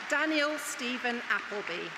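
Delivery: usual speaking voice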